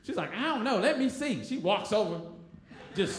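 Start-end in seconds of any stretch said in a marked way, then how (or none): 0.3-1.4 high, pushy girl imitation; Tracy. Girl going over to unmask the stranger, mildly pushy imitation. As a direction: raise the pitch, lightly bossy. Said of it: "Tracy was always real aggressive".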